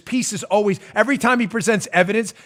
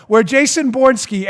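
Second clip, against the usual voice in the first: normal talking voice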